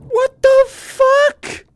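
very high, loud, drawn-out wail; shocked disbelief. Real, not acted. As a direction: stretch it into a high shout of shock. Shocked by game event.

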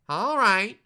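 very high, loud little goblin voice; squeaky goblin. A narrator's reading. Little goblin gives in to Herschel, squeaking. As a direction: squeak it loudly, far above your own pitch. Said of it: "he squeaked".

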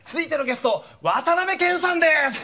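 MC announcing. Retold or imitated. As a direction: introduce the star guest. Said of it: "って発表したん". (司会者の呼び込み)

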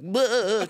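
high, loud wobbling groan; imitated straining noise. Comic picturing his wife holding it in the chair, mock straining noise. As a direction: mimic someone jiggled while desperately holding it.

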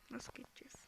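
whispered question to herself; quiet pondering whisper. Real, not acted. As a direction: whisper the puzzle under your breath. Whispered puzzling.

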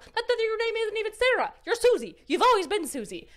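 high, loud accusing rant; hammed-up climactic shouting; dramatic outburst. Mounting dramatic exposure of the lie.

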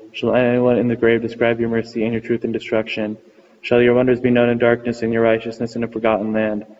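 ordinary talk, nothing marked

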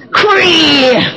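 high drawn-out call of "Chris"; Grandma calling names. Retold or imitated. Grandma calling a girl named Crystal, high drawn-out call. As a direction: holler the name long, like Grandma. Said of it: "she said them wrong".

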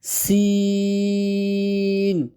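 সিন hissed, its "ee" held very long; long airy সিন. Shown as a demonstration. Teacher showing the length, সিন drawn out on one note. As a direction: stretch সিন on a steady pitch with a sharp "s". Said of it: "চার আট লম্বা করবেন".

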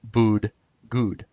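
Bood, bud bent to rhyme with good; slurred to rhyme. Shown as a demonstration. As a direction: slur bud into Bood, then good, word by word. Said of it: "We can slur bud and good".

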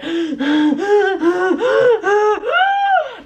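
loud, high gasping breaths, hammed up; mock heavy breathing. Imitating boys breathing in your ear, hammed-up heavy breathing. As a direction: exaggerate panting right in someone's ear, building. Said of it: "boys breathing ... and they're going".

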